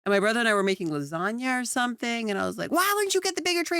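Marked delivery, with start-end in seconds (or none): 2.7-3.8 high, rapid mock squabble; loud sibling bickering. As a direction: imitate bickering with a brother, fast and high. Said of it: "and we're loud".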